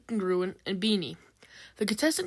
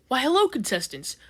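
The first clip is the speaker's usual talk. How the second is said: cheerful; 5 semitones higher, 3 dB louder than usual. A narrator's reading. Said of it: "Volkswagen cheerfully says".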